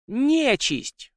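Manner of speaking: sudden high-pitched cry of alarm. A narrator's reading. Victoria spots an unclean creature in the house, sudden alarmed cry. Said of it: "вдруг закричала Виктория".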